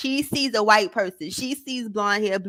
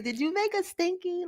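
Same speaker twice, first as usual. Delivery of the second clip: put-on mom to toddler; 7 semitones higher, 9 dB quieter than usual. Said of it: "that's what I say to my son".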